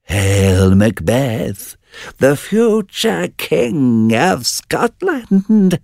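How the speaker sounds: very high, very slow eerie witch call; witch crying out. Acted. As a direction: draw out the final prophecy, eerie. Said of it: "cried the third".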